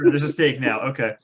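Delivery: usual speaking voice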